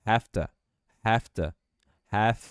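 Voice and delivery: "have to" as "hafta". Shown as a demonstration. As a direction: turn the V into an F. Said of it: "com som de F".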